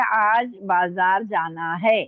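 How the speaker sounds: unhurried, evenly spaced words; slow dictation. Real, not acted. Teacher reading back the finished sentence, slow dictation. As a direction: read it back slowly for writers. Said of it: "मैं आराम आराम से बोल रही हूँ".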